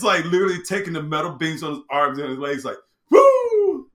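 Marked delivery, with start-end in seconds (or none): 3.1-3.9 high, loud "woo" of flight; loud, soaring imitation of flying; whooshing "woo". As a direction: whoop it like someone shooting into the sky.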